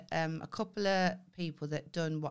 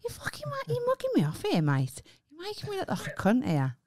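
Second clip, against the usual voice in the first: flustered protest; 11 semitones higher than usual. Real, not acted. Caught out on a call, protesting.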